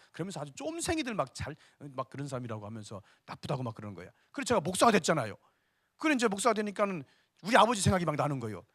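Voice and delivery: ordinary delivery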